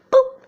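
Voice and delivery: very high, loud little boop; imitated boop. Storyteller imitating the little door popping open, high boop. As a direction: pop out a squeaky door noise.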